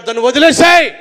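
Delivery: loud preaching shout; shouted command. Acted. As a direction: bark the order at the crowd. Preacher commands giving up sin. (గట్టిగా అరిచే ఆజ్ఞ)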